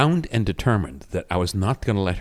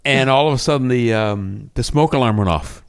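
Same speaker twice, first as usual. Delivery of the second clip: ordinary delivery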